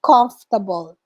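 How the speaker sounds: comfortable as KUMF-tuh-bul, three syllables; correct comfortable. Shown as a demonstration. Teacher showing the class proper comfortable. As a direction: model comfortable the British way. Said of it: "pronounced as comfortable".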